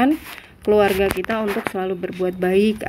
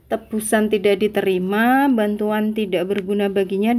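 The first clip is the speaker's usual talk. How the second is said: ordinary delivery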